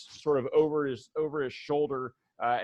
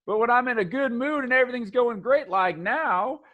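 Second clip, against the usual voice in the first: higher and quicker hustler pitch; upbeat salesman dude, higher; eager shop guy; 6 semitones higher than usual. Acted. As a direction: sell them on piercings and ink. Kip explains mood tattoo.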